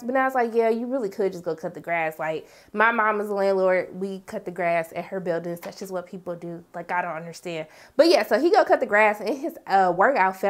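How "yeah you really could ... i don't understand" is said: quieter aside. Real, not acted. Quiet aside on grass cutting.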